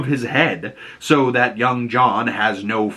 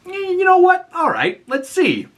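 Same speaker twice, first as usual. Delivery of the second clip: mock Hal challenge.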